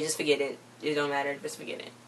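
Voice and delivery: low and soft, fading to a whisper; dejected brush-off. Retold or imitated. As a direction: let it drop to a whisper at the end. Bianca dismissing her mother.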